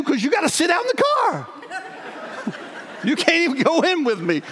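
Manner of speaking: laughing through it; laughing retort. Retold or imitated. To his wife on the phone, laughing. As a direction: scoff with a laugh.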